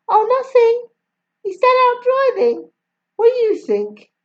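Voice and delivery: a Jamaican mum complaining about her husband; Jamaican accent. A narrator's reading. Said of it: "I will try my best".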